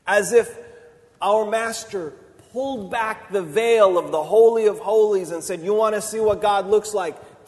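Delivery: loud, rising sermon voice, slowing at the end; preaching at full force. Acted. Preacher proclaiming God's heart to the congregation, full force. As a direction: build to a ringing climax for the congregation.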